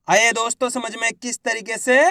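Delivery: ordinary delivery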